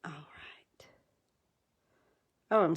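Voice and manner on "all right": "All right" breathed as a soft whisper; barely-there whispered aside to herself. Real, not acted. Crafter murmuring to herself mid-project, whispered.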